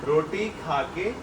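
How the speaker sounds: feed the phrase slowly, piece by piece; slow prompting. Real, not acted. Father prompts child part by part, slowly.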